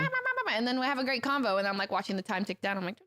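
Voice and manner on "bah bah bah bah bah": squeaky high blah-blah imitation; high babble. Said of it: "And they go".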